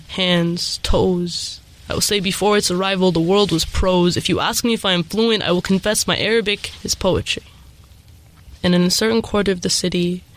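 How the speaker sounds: ordinary talk, nothing marked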